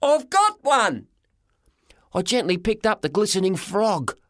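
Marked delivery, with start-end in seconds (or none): 0.0-1.1 high, loud joyful cry; screaming with joy. A narrator's reading. Boy shouting to grandpa he found the frog, high joyful cry. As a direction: yell it out, overjoyed. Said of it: "I screamed".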